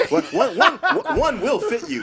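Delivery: laughing mock sales pitch. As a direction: ham up the sales pitch while cracking up.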